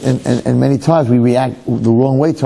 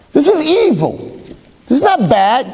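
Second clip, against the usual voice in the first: indignant; 13 semitones higher than usual. Retold or imitated. Rabbi retells his outburst.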